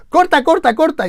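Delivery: high, loud call to stop; urgent mock shout. Retelling a botched recording, urgent mock shout to cut. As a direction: shout it like halting a take in a panic.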